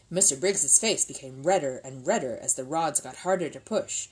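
plain reading voice

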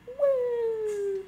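high, long falling "woo"; excited whoop. Real, not acted. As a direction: whoop as if leaping off. Whoop during a jump.

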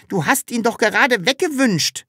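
high-pitched exclaimed accusation; excited character cry; calling out. A narrator's reading. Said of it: "rief das Sams".

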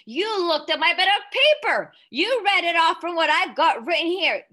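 high, loud accusing shout; father accusing Matilda of reading his paper, shouting; angry shout. Acted. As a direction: yell the accusation at her.

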